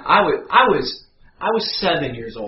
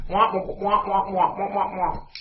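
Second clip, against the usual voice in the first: higher droning wordless preacher at usual volume; Charlie Brown's teacher; 5 semitones higher, slower than usual. Retold or imitated. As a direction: keep up a meaningless wah-wah drone. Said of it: "He sounded like Charlie Brown's teacher".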